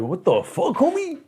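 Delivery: hot-headed mock outrage, shooting up high on "homie"; Carlos. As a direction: imitate an angry cousin without real volume. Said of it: "Carlos came out hot".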